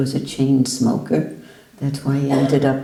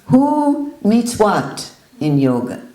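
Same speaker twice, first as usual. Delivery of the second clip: forceful questioning; 4 semitones higher, 4 dB louder than usual. Retold or imitated. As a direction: hammer the question at him. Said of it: "And he said".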